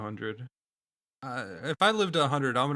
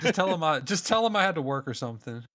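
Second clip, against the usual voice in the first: ordinary talk, nothing marked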